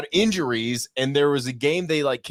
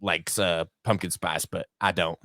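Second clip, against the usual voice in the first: Southern drawl impression; 7 semitones lower than usual. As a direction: drawl it out like a country boy. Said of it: "Can you do an impression of the voice".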